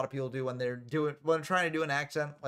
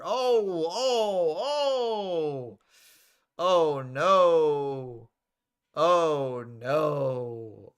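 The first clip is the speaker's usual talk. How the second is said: loud, drawn-out falling ohs; shocked outcry; 3 semitones higher, 8 dB louder, much slower than usual. Real, not acted. Commentator watching a brutal move, loud shocked outcry.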